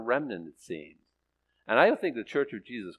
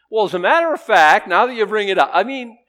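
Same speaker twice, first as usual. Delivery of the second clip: high, booming burst of readiness to answer; loud eager reply; 8 semitones higher, 12 dB louder than usual. Acted. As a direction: jump in loudly, pitched well up. Acting God's ready answer to prayer.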